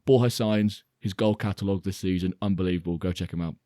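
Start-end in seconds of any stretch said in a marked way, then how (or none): none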